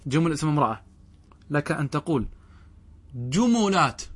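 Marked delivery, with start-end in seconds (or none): 3.1-4.0 جملات said "jumulāt", higher, u after the m; damma on the mim. Shown as a demonstration. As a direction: announce جملات as ju-mu-lāt. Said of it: "بالضم". (جملات بضم الميم)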